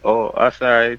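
ordinary talk, nothing marked

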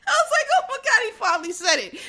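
shrieking high through laughter; screaming with laughter. Real, not acted. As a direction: burst out laughing in disbelief. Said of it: "I screamed".

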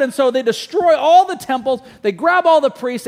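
normal talking voice

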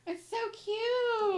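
high, slow, loud coo over a cute photo. Real, not acted. Cohost seeing the bus driver photo, cooing high and slow.

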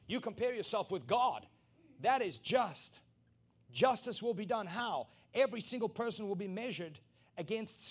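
loud, high pulpit preaching; preaching at full force. Acted. Preacher exhorting the congregation, loud and high. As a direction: drive it home to the whole church.

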